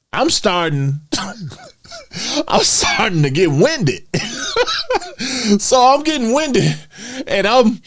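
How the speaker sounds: high laughs around the words; laughing. Real, not acted. Admitting to viewers he got winded, laughing. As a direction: laugh through the embarrassment.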